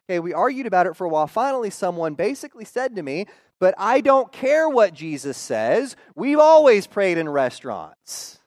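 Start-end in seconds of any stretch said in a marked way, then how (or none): 3.6-8.4 stubborn indignant retort. Retold or imitated. Church member's stubborn retort.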